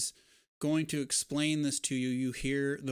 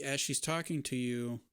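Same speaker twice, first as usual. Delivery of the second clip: ordinary talk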